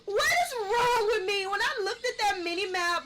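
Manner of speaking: loud, high, drawn-out wail through laughter; laughing shriek. Real, not acted. Player laughing at own mistake on the mini map, shrieking. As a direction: cry it out, cracking up at yourself.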